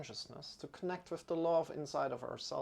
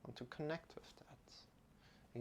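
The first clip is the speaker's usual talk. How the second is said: slow, soft whisper; 2 semitones higher, 12 dB quieter, slower than usual. Acted. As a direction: breathe it slowly.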